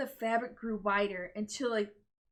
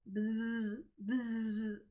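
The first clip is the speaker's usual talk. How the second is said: buzzing; 3 dB quieter than usual. Acted. Vibrating sound effect.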